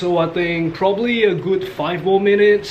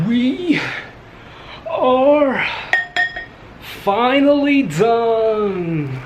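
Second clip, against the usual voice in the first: triumphant announcement; 6 semitones higher, much slower than usual. Real, not acted. Triumphant announcement after long whisking.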